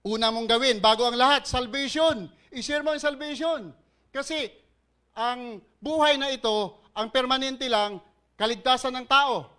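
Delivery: loud, high, driving preacher's call; fiery preaching. Acted. Pastor urging the congregation to trust the Lord, full-voiced preaching.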